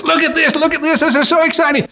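very high, thrilled squeal; excited young man. Acted. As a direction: gush with childlike delight. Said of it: "And he says".

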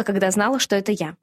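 usual speaking voice

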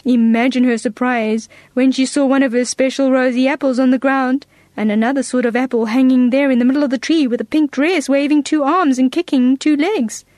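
usual speaking voice

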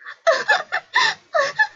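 high-pitched acted sobbing in bursts; crying. A narrator's reading. Little prince weeps on the grass, acted high sobs. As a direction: sob in short, high bursts. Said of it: "ağlamaya başladı küçük prens".

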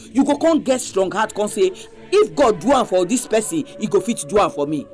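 ordinary talk, nothing marked

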